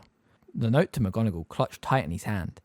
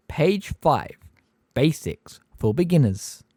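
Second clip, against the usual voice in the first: Umbridge; 8 semitones higher, 4 dB louder than usual. A narrator's reading. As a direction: spell it out with sugary menace. Umbridge's sugary reassurance.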